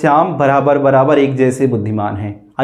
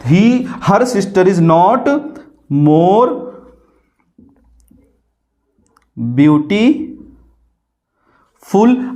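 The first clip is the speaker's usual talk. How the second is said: slow dictation; much slower than usual. Shown as a demonstration. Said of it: "teacher writes while dictating".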